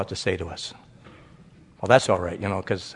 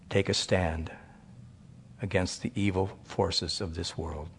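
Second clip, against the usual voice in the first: softer, measured closing prayer; 4 semitones lower, 6 dB quieter than usual. Acted. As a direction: pray gently over the congregation.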